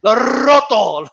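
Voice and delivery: "roto" with a long, exaggerated trilled R; Miguel Cotto. Imitating the boxer rolling his R's, "roto" hugely trilled. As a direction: draw out the rolled R in "roto", slowly. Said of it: "started rolling those R's".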